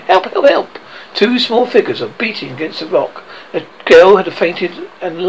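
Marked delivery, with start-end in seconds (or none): 0.1-0.8 feeble cry. A narrator's reading. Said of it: "a feeble cry".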